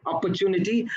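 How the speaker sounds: "opportunity" said clearly, syllable by syllable; model pronunciation. Shown as a demonstration. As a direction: show the proper "opportunity". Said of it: "pronounced very nicely".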